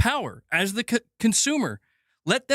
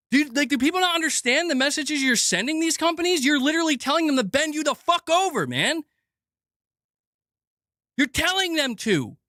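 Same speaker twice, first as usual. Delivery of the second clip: normal talking voice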